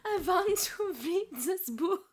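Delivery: high, slow mock vampire accent; silly Dracula-style accent, drawn out. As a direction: do a laughing, hammed-up vampire accent. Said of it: "It's like a vampire accent".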